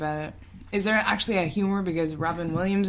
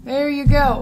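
ordinary delivery

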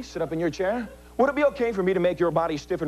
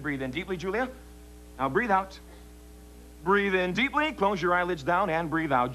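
hypnotic induction. Acted. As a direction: lull her down gently.

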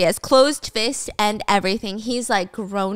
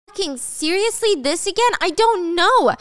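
high-pitched exasperated outburst; exasperated protest, pitched up; 8 semitones higher than usual. Acted. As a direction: act fed up and disbelieving. Acted exasperation at repeated accusation.

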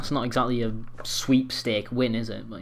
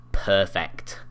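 ordinary talk, nothing marked

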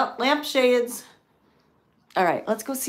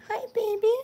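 high, worried coo; 11 semitones higher than usual. Real, not acted. Woman asking a crying cat what's wrong in baby talk.

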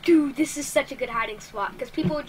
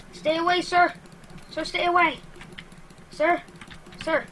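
raised, urgent pleading; short, higher bursts warding someone off; alarmed warning; 4 semitones higher than usual. Pretend. Player telling a wolf to keep away, alarmed.

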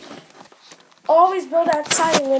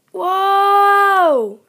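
loud, long, higher drawn-out whoa; 5 semitones higher, 7 dB louder than usual. Acted. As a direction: hold the whoa through the loop. Smudger loops the bend.

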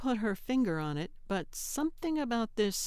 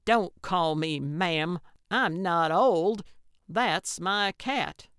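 slow, loud, indignant demand; 7 dB louder, slower than usual. A narrator's reading. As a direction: lay down the law, loudly and slowly. Gertrude confronts boy over cat.

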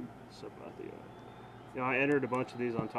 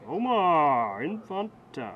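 slow, louder ballpark-announcer name call; mock announcer; 2 semitones higher, 7 dB louder, much slower than usual. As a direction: call it out like a stadium PA. Announcing a pulled card.

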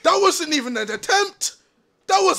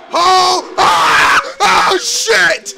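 loud frightened cries and curses; panicked yelling; 5 dB louder, much slower than usual. Real, not acted. Player attacked in the game, panicked yelling. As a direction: yell out as the scare hits.

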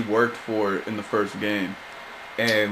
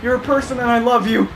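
laughing plea; 12 semitones higher, 3 dB louder than usual. Real, not acted. Pleading with the monster.

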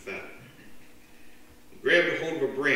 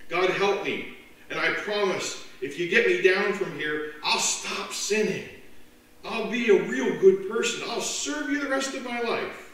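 desperate pleading; 8 semitones higher, 5 dB louder than usual. Acted. Man begging God.

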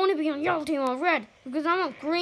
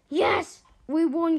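excited cheer; 3 semitones higher, 4 dB louder, slower than usual. Real, not acted. Player telling guys they won, excited cheer. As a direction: celebrate the win.

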